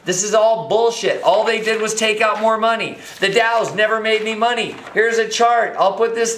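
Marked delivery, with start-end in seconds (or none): none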